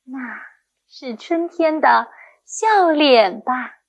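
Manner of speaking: 笑脸 stretched out and loud; lingering emphasis on 笑脸, then easing off; expressive model reading. Shown as a demonstration. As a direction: read slowly, leaning hard on 笑脸. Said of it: "重音和语气该怎么注意呢".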